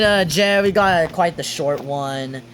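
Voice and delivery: normal talking voice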